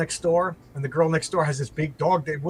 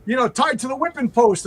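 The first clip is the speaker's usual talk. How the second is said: high mocking tease; teasing buddies; 8 semitones higher than usual. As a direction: rib him like a sitcom pal. Friends tease husband.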